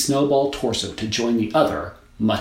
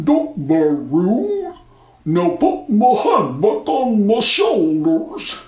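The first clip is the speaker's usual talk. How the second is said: loud, higher, slow snowman scold; bossy snowman; 11 semitones higher, 8 dB louder, much slower than usual. A narrator's reading. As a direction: boss her as a snowman.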